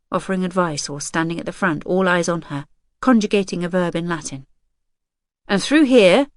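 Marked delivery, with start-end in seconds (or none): 5.5-6.3 loud, high call across the room. A narrator's reading. Hal calling his mother from a far doorway. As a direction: call her over from far off. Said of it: "Hal calls her from a doorway".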